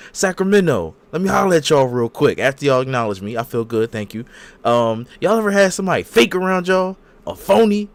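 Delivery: loud, high hype-man promo; mock wrestler promo. Roman Reigns hyping up the Sacramento crowd, loud promo voice. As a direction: work the arena crowd up.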